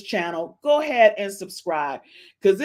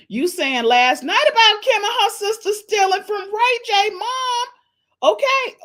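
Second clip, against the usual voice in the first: high, loud, rushing gossip; excited high gossip; 12 semitones higher, 5 dB louder than usual. Retold or imitated. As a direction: spill the tea breathless. Excited retold gossip question.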